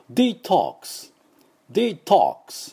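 British "デトックス" as dee-TOKS, rounded O; British English accent. Shown as a demonstration. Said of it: "多分イギリス人だと".

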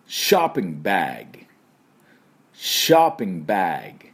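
ショッピングバッグ as English "shahping baeg"; American shopping bag. Shown as a demonstration. Teacher modelling for learners, ショッピングバッグ in American English. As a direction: open "shah", bare g ending in ショッピングバッグ. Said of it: "私の後ついていきましょう".

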